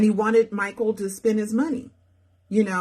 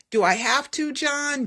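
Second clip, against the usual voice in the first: higher, small pleading voice; reluctant Michael; 7 semitones higher than usual. Acted. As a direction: beg not to go through with it.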